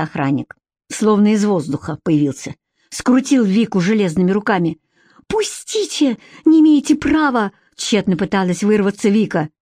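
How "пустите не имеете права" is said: high-pitched cry of protest; desperate protest. A narrator's reading. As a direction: struggle to get free, voice leaping up. Vika struggles in guard's grip.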